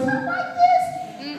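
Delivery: very high, slow squeak; high squeaky voice. Shown as a demonstration. Speaker demonstrating Ellen's squeaky voice, very high. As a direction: pitch way up like a squeaky voice. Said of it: "high, squeaky voice".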